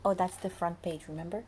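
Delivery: usual speaking voice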